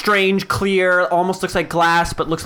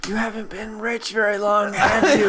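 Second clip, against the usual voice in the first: mocking shopkeeper chuckle; 5 semitones higher than usual. Shopkeeper mocks rich player.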